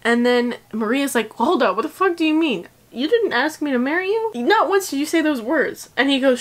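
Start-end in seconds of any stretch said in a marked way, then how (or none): 1.3-5.9 indignant outburst. Retold or imitated. Maria confronts fiancé in retelling.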